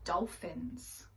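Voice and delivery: usual speaking voice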